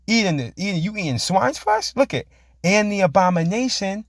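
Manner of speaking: preached scripture. Acted. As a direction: stretch each word like a proclamation. Reads scripture aloud.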